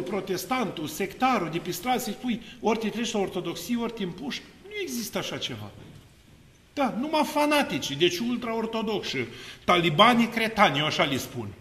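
usual speaking voice

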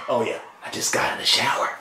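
fast breathy whisper aside. Acted.

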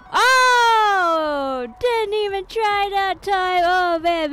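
high, loud outburst; excited exclaiming. Real, not acted. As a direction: exclaim loudly over the game point. Player exclaims during air hockey.

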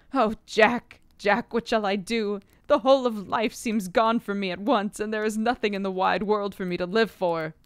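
loud anguished crying, voice breaking. A narrator's reading. Arthur breaks down on his friend's shoulder, crying loudly.

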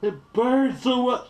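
high, loud and slow, in real pain; pained wail. Real, not acted. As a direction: wail it out as the heat hits. Ghost pepper burn peaks.

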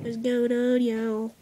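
mock American drawl. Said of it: "What happened to my China accent".

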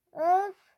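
exasperated groan. Real, not acted. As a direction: let out a loud huff of frustration. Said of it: "Sinir oldum".

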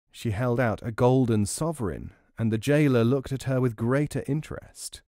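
ordinary delivery

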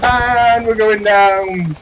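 slow, loud, dramatic; dramatic announcement. Pretend. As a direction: announce the doom slowly. Helicopter going down.

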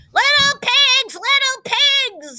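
high, loud, drawn-out wolf call; menacing singsong at the door; big bad wolf. A narrator's reading. Wolf calling to the pigs inside, high drawn-out menace.

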